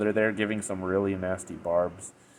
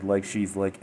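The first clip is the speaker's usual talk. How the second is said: ordinary talk, nothing marked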